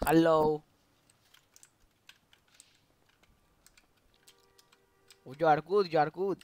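loud broken English in a put-on Arabic accent; fake Arabic accent. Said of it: "I'm Arabic". (ψεύτικη αραβική προφορά, δυνατά)

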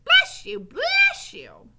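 very high, loud repeated cries; Mig's shout. A narrator's reading. Mig blessing Gregory's sneezes, high shouts. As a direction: shout it twice over. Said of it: "shouted Mig".

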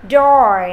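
loud "Door" with full curled R; model pronunciation. Shown as a demonstration. Teacher confirming the practised word, loud clear "Door". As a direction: say "Door" strongly, holding the rounded R. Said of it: "Chúng ta đã đọc được rồi".

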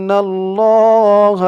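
ইন্নাল্লাহ with the nn humming through the nose; held ghunna. Shown as a demonstration. Teacher demonstrating the obligatory ghunna. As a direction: hold the nasal nn in ইন্নাল্লাহ. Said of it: "ওয়াজিব গুন্না".

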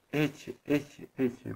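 soft, dwindling echo; echo answer. A narrator's reading. Stones echoing the wolf's question, soft echo. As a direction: repeat it softly like a distant echo. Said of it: "begon het antwoord".

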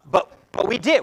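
ordinary talk, nothing marked

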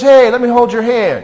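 loud, high fake-friendly voice; imitating the stranger. The man reaching for the boy's hand, loud coaxing. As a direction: put on his coaxing tone, loudly.

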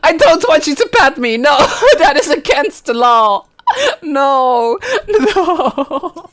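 mock outrage at the screen; outraged yelling. Real, not acted. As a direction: yell it through giggles.

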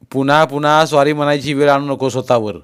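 stern admonition. A narrator's reading. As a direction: warn him firmly. Father admonishes after the apology.

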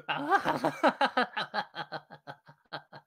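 high, loud laugh that trails off; rapid staccato cackling, fading away; long laugh. Real, not acted. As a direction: burst out in a long run of ha-has. Long loud laugh after a slide.

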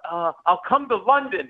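high, flustered outburst; panicked blurt. Acted. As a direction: blurt it out before you can think. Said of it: "I just blurt out".

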